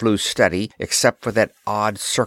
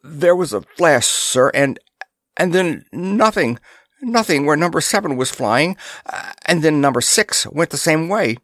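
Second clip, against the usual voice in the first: husky, halting and higher-pitched; 7 semitones higher than usual. A narrator's reading. Lookout reporting lost ships to his officer, husky. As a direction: catch your breath and report it huskily, haltingly. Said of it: "said huskily".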